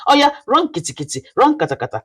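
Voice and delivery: fast, high sing-song channel hype; playful hype. Acted. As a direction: rattle it off like a cheerful hype call. Channel intro hype chant.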